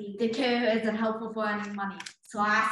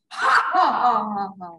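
villain laugh; 5 dB louder than usual. Acted. Jezebel triumphs over the children with an evil cackle.

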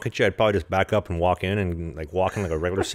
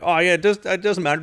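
higher-pitched breezy quote of oneself; eager brush-off; 9 semitones higher than usual. Retold or imitated. Quoting self begging to watch fight.

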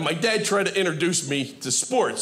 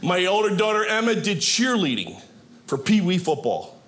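ordinary talk, nothing marked

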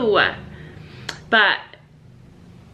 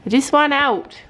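voicing the bird, second take; 5 semitones higher than usual. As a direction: play the bird begging to get out of his cage. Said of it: "He's like".

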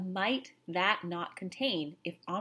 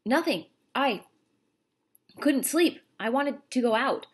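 put-on higher, louder, caught-out boy; nervous boy; 6 semitones higher, 5 dB louder than usual. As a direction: stammer an excuse to dad. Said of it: "He felt himself break into a sweat".

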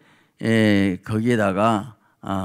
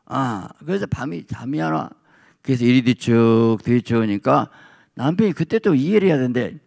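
ordinary talk, nothing marked

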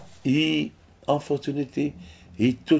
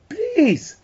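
high, begging plea; pleading mouth; 7 semitones higher, 3 dB louder than usual. Pretend. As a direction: beg in a high voice like a frightened mouth. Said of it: "The mouth is screaming".

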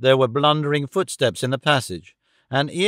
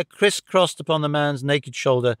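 plain reading voice

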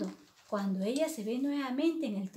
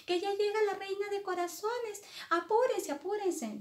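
much higher, urgent gardener voice; hammed-up frightened urging; 10 semitones higher than usual. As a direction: warn them the boss is coming, high and flustered.

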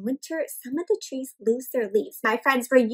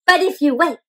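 storybook reading; 5 semitones higher, 4 dB louder than usual. A narrator's reading. Teacher reads book aloud.

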